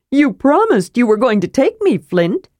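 an innocent kender who tagged along uninvited; cheerful kender. Acted. Tasselhoff speaks.

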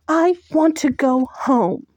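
high, loud, near tears; desperate plea. Acted. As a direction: cry it out, longing.

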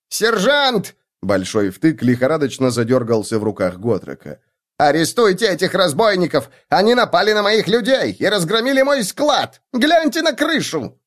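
high, urgent appeal; high frantic halfling shout; squealing halfling. A narrator's reading. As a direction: cry out to the sergeant. Halfling calls the guard.

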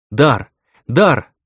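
loud urgent calling. Acted. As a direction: shout his name to wake him. Girl shaking him awake. (громкий зов)

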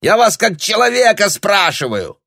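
high, loud, exasperated yell; shouted appeal. Acted. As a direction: demand it at full voice. Shouted demand in the quarrel.

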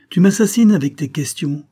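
high, loud mock despair; exasperated protest. Évonyme fends off Jacques's questions with a loud, high cry of mock exasperation. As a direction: throw up your hands at the questions.

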